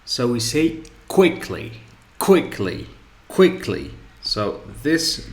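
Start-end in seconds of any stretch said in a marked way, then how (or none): 1.1-3.9 "quickly" with the first syllable punched, the second dropping away; stress on first syllable. Shown as a demonstration. Teacher drilling the example adverb, first syllable of "quickly" stressed. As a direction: hit the start of "quickly". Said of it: "the first syllable is the place of the stress".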